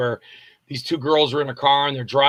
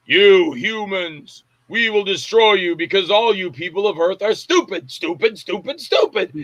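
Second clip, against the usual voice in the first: slow, drawn-out alien invader voice; booming arrogant alien overlord. Acted. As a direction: play the sneering alien overlord. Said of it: "I'm gonna do an homage to Plan nine".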